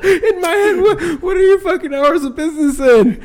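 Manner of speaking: high, loud words through helpless laughter; laughing. Real, not acted. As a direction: barely get it out for laughing. Retelling his own thought, laughing.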